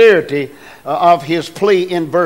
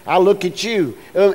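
normal talking voice

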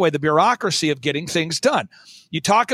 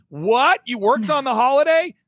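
mock disbelief; 5 semitones higher than usual. Mock listener reacting in disbelief.

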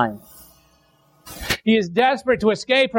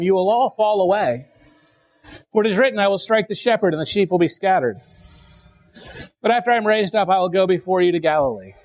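measured, solemn Bible reading, slowing into the weeping; scripture reading. Acted. Pastor reads the Gospel to his congregation, solemn reading. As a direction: read the passage like a pulpit lesson.

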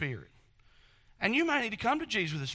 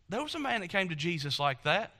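usual speaking voice

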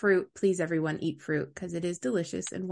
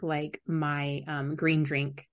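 usual speaking voice

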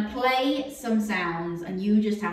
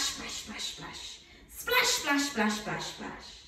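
whispered splash; 8 dB quieter, slower than usual. Shown as a demonstration. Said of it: "Can you say splash".

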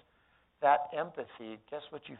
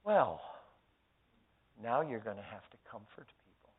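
sly smile; 4 semitones lower, 3 dB quieter than usual. Retold or imitated. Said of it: "smiled a little sly smile".

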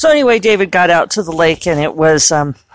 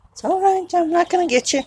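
soothing; 11 semitones higher, faster than usual. Real, not acted. Reassuring birds outside.

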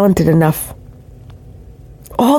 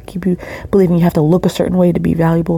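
ordinary delivery

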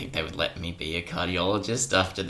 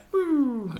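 machine noise. Retold or imitated. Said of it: "So the machine just was like".

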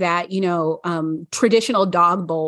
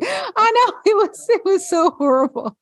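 laughing; 13 semitones higher than usual. Real, not acted. Laughing at her own blunder.

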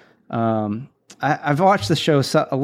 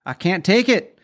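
higher, fed-up storming-out voice; mock exasperated quitter; 7 semitones higher than usual. Quitting worker storming out, mock exasperated. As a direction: toss it off like you're walking out the door. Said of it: "very actively going".

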